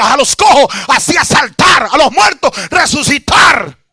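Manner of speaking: high, loud revival shout; fervent pulpit cry to the congregation; full-force preaching. Real, not acted. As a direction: preach it out loud, stretching the big phrases.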